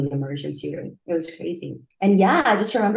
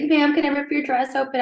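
lightly voiced paramedic, pitched up; a hint of a paramedic's raised-pitch request; retold paramedic; 9 semitones higher than usual. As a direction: ask urgently in a higher voice, as a retold quote. Voices paramedic asking permission.

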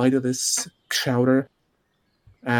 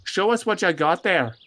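put-on higher-pitched, folksy Minnesota accent; 5 semitones higher than usual. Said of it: "what was the accent?".

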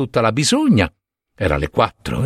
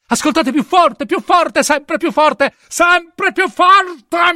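each repeat higher, the last one slow and screamed; rising frantic shout; 13 semitones higher, much slower than usual. Acted. Urla sempre più forte agli ufficiali. (urlo frenetico che sale sempre più)

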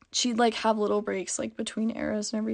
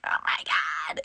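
retold whisper; 6 semitones lower, slower than usual. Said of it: "I was like".

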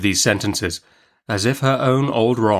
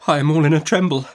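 higher, quick, anxious voice; faltering; 6 semitones higher, 3 dB louder, faster than usual. A narrator's reading. Mother tells the aunt she is unwell, faltering. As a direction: falter it out in mild panic. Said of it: "faltered my mother".

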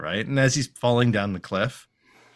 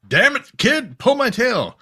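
high, loud mock scolding; high-pitched cartoon demand; exasperated Bighorn; 12 semitones higher, 5 dB louder than usual. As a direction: scold the kid with hammed-up exasperation. Acting out Bighorn's exasperated order.

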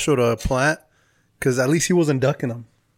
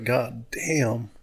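soft, hushed disbelief; stunned; 2 semitones lower, 6 dB quieter than usual. Retold or imitated. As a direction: say it under the breath, amazed. Stunned reaction on hearing heroin.

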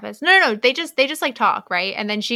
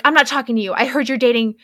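louder, a hammed-up indignant snap; mock angry roommate; 3 semitones higher, 7 dB louder than usual. Roommate Malcolm confronts Olive about Adam, louder in mock anger. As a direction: play the betrayed friend with mock outrage.

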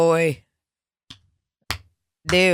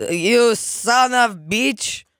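put-on Russian accent; 6 semitones higher, much slower than usual. Mock Russian insult echoed.

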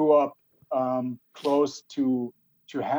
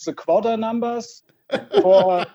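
higher, hammed-up German conference-call English; mock German English accent; 6 semitones higher, 4 dB louder than usual. Mocking German coworkers on calls, hammed-up accent. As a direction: parody a German colleague on a call to Americans. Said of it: "So I have to listen to this all day long".

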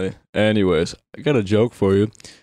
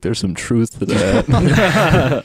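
laughing mock confession; 5 semitones higher, 3 dB louder, much slower than usual. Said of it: "Paul's like".

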